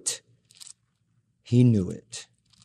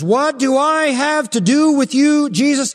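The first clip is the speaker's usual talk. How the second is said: shrill demon pleading, higher and louder; panicked demon voice; 11 semitones higher, 5 dB louder than usual. Acted. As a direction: shout it out like a terrified demon. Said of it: "The demon says".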